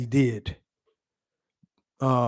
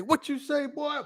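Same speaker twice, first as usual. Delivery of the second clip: father's stern question; 9 semitones higher, 7 dB quieter than usual. Retold or imitated. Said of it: "I remember he said".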